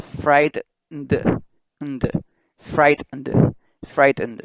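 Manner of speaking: "frightened" split as "frait-n-d", both Es silent; letter-by-letter sounds. Shown as a demonstration. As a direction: say "frightened" slowly, sounding N and D separately. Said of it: "el sonido de cada letra". ("frightened" separado: "frait-n-d", sin las E)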